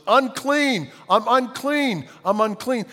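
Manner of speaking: high, loud cry of unclean; lepers yelling a warning. Retold or imitated. Preacher voicing lepers warning travelers, high loud yell. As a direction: call it out high like a warning from afar. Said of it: "they had to yell to the person".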